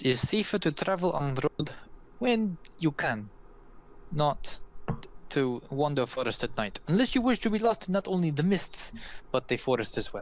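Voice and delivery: put-on accented counsel, lifting on the warning; patient foreign woman explaining the danger; cautioning Vistani woman. Vistani woman explains forest dangers to travelers, accented and cautioning.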